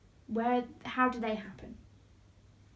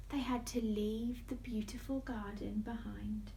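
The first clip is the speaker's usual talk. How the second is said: hushed sorrowful telling; whispering; 2 semitones lower, 7 dB quieter than usual. A narrator's reading. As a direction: soften it to a whisper. Sad consequence.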